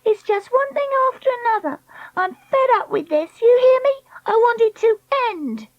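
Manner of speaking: put-on high-pitched, fed-up girl's outburst; tantrum. Treacle fed up on the trolley, throwing a tantrum. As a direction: wail it way up high.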